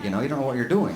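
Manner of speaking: ordinary talk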